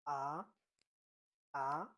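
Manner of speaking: Mandarin second tone on AH, sliding upward. Shown as a demonstration. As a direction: let AH climb like a question. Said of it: "So the second tone".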